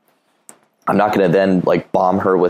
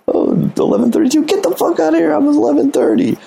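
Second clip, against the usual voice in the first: squeaky, hammed-up amazement way up high; mock shriek of fake coincidence; squealing disbelief. Guy faking the same address to her, squealing mock amazement.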